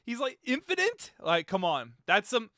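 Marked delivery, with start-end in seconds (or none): 0.4-1.1 high, rising mock-frightened question; mock scared squeak. Streamer mocking Sonic's voice to chat, mock fearful. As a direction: parrot Sonic's nervous question. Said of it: "a little bit of fear in that voice".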